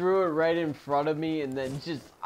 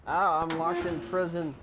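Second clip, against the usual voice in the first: ordinary talk, nothing marked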